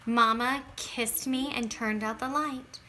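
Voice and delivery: plain reading voice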